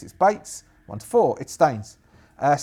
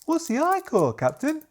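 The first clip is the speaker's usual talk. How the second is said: much higher, puzzled question; naive young soldier; 12 semitones higher than usual. Acted. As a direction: ask it high and clueless. Young soldier asks captain.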